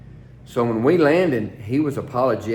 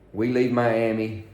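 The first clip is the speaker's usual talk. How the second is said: ordinary talk, nothing marked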